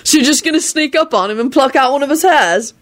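high, eager question; interested. A narrator's reading. George asking about plucking hairs, interested. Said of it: "George asked interestedly".

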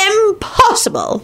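put-on high, slow dismissive old man; disdainful high-pitched old wizard; haughty sniff. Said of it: "the old man sniffed".

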